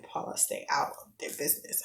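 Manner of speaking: whispered, urgent aside; hushed warning. Retold or imitated. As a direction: hush her without being overheard. Son's father hushing her.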